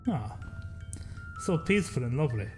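ordinary talk, nothing marked

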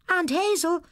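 high, loud call. Acted. Girl calls for aunt in empty shop, loud call. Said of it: "she called".

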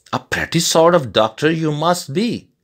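high-pitched, scoffing outcry; crying out. A narrator's reading. Villagers challenge the pretended doctor, crying out. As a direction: cry it out high, as a storyteller would. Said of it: "cried they".

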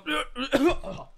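exaggerated clearing of the throat; loud throat clear. Shown as a demonstration. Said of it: "just go".

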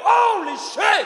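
loud, high, drawn-out dad voice; awestruck father. Retold or imitated. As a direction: exclaim as a stunned Dad, stretching it out. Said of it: "And he went".

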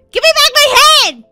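high, loud, fast demand; outraged yell. Real, not acted. Game took her head.

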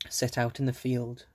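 ordinary delivery, nothing marked

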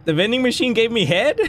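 normal talking voice

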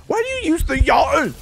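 shrill-sounding disbelief at the enemy; high, exasperated yelp. Real, not acted. Frustrated at enemy.

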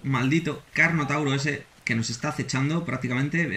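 normal talking voice

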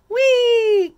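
loud, high squeal of fun; joyful storybook cry; gleeful whee. A narrator's reading. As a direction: whoop as if sliding down. Snails playing, sliding.

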